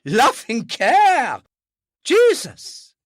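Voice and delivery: high, loud, slow scoffing explosion; father exploding at the doctor's words; outraged outburst. Acted. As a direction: blow up at the very idea.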